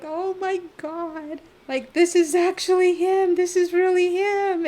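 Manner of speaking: high, loud fangirl gushing; re-enacted giddy thrill, building from stretched-out to loud; starstruck excitement. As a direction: squeal it in starstruck disbelief. Starstruck inner exclamation.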